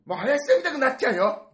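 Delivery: loud, high mom warning; scolding mother. Retold or imitated. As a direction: warn the child loudly. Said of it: "みたいな".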